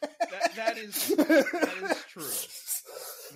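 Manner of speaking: high, loud words through laughter; real helpless laughter; laughing agreement. Real, not acted. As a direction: agree while cracking up, dragging out the end. Laughs while agreeing with friend.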